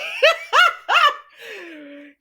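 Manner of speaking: loud, very high cackle; shrieking laugh. Real, not acted. Host cracks up at the plot, shrieking laugh. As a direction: burst out laughing at full pitch.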